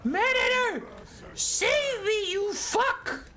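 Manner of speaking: very high, frantic plea for rescue; panicked unit voice. Pretend. Doomed unit begs the Maneater to save it, very high and frantic. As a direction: squeal for help like a doomed soldier. Voicing a dying unit begging.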